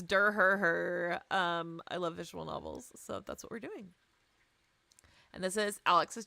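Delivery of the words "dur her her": goofy dork laugh. Pretend. Goofy nerd laugh after liking novels.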